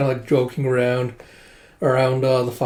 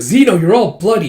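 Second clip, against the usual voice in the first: higher, louder fussing; alarmed scolding; 5 semitones higher, 6 dB louder than usual. Retold or imitated. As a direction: fuss in dismay at an injured friend. Yona scolds hurt Zeno.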